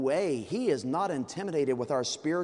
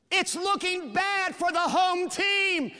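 sports announcer; 14 semitones higher, 5 dB louder, slower than usual. Acted. Mock sports commentator.

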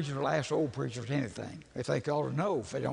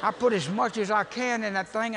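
ordinary talk

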